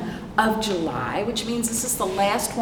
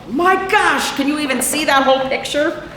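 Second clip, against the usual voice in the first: amazed; 8 semitones higher, 6 dB louder than usual. Real, not acted. Amazed at huge whale picture.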